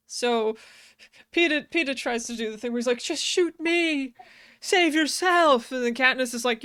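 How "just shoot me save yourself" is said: mock melodrama. Retelling Peeta's noble plea.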